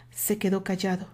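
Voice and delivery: plain reading voice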